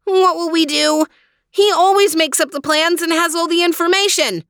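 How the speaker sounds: distressed cry. A narrator's reading. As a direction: wail helplessly in a high voice. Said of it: "cried Theo".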